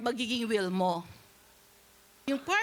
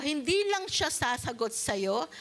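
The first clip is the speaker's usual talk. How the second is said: ordinary talk, nothing marked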